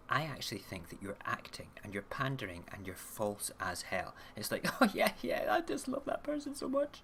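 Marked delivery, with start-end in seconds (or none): 4.8-7.0 mock gushing, much higher voice; fake gushing. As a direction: gush like a false, pretentious fan. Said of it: "like, totally false".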